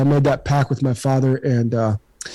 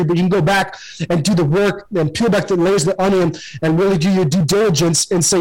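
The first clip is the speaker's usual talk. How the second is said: ordinary talk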